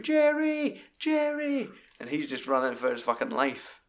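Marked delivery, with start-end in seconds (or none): 0.0-1.7 shrill mock scream, much higher; hammed-up chase scream after a fleeing man; screaming his name. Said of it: "just screaming".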